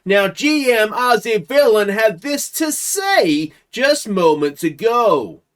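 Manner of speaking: high, slow, booming news announcer; breaking news announcer. Acted. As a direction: stretch every word out like a hyped-up breaking news bulletin.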